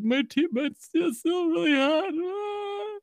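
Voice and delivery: put-on very high, pained child voice; whimpering kid. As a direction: whine like a little kid whose mouth burns. Kid with burning mouth whines.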